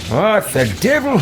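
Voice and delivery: sudden high startled exclamation. Acted. Villain startled by an enemy spell, surprised outburst. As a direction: snap out a shocked curse, high.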